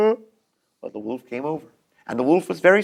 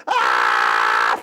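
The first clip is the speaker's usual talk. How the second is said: deep charging roar; low charging yell. Acted. Wolf charging at the door, deep yell.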